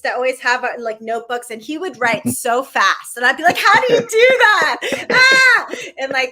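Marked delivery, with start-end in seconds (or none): 3.6-5.7 high, loud, hammed-up exasperation; retold outburst, pitched way up and yelled; exasperated mock yell. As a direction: wail it in amazed frustration, ending in a scream. Mock exasperated yell at fast writer.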